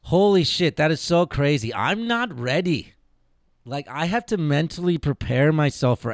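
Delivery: news that the season is near brings excited surprise. Real, not acted. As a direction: exclaim in happy disbelief. Reacting to NBA news.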